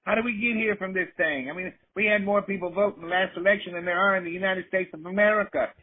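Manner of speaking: mock a rattled politician, way up high.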